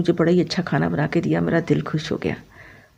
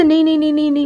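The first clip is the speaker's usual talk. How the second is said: imitating a boss's high, excited string of no's; insistent refusal; 10 semitones higher, much faster than usual. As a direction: wave away the objection eagerly, pitched up. Boss brushes off the objection.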